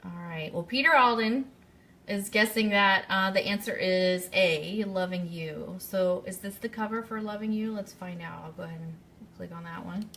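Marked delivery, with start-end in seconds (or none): none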